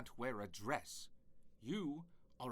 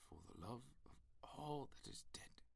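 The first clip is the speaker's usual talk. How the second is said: irritated whisper; 3 semitones lower, 8 dB quieter than usual. Acted. Irritated god cuts Becky off.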